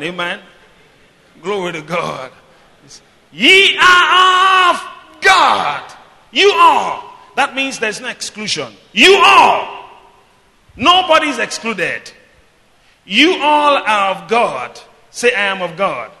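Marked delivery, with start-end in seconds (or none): 3.3-14.8 loud, drawn-out proclamation; emphatic preaching. Real, not acted. Preacher telling the church nobody is excluded, emphatic preaching. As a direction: hammer each phrase home at high pitch.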